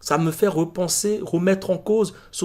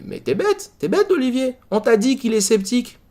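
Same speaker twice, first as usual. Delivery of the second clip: replayed telling-off, pitched up high; exasperated self-scolding; 7 semitones higher, 3 dB louder than usual. Viewer scolding himself for missing the obvious, exasperated. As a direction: burst out at yourself in mock exasperation.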